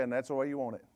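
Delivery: wife's retort. Retold or imitated. Wife answers her husband's complaint in a low, dry tone. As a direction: say it low and dry, case closed. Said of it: "she'll say".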